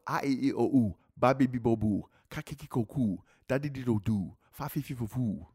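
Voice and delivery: rhythmic run of consonant-vowel syllables; articulated alphabet exercise, evenly chanted; vocal warm-up drill. Shown as a demonstration. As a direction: rattle off each syllable set like a drill. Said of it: "we do the alphabet".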